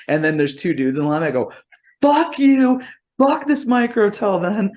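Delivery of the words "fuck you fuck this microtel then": high-pitched re-enacted yell; hammed-up storming-out shout; angry outburst. Retold blow-up at the motel clerk.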